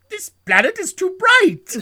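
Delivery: high, loud, slow alien wail; hammed-up put-on cry of complaint; complaining alien. Alien Nuki protests at the rising sun, mock wail. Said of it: "looks at the sun and goes".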